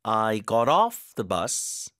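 very slow, weight on "off" in "got off"; slow demo stressing "off". Shown as a demonstration. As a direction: lean on "off". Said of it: "뒤에 힘 주고".

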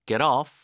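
"Get off" with the t flapped like a light r; American flap on "Get off". Shown as a demonstration. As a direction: link "Get off" as "ge-roff". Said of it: "T 발음은 리을 발음처럼".

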